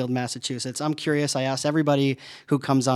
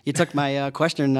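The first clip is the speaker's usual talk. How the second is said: ordinary talk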